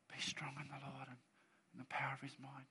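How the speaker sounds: mock timid whisper. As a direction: breathe it out, barely audible. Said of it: "I don't think he was being like".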